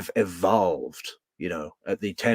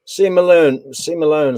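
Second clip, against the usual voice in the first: loud call-out; 5 semitones higher, 8 dB louder than usual. Real, not acted. Reading a viewer's name out.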